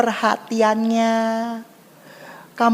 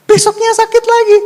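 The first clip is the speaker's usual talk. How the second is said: sudden high, loud outburst; exclaimed punchline; 12 semitones higher, 12 dB louder than usual. Real, not acted. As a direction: burst out shouting the comic letdown. Kejutan lucu setelah cerita sembuh. (seruan keras melengking yang mengejutkan)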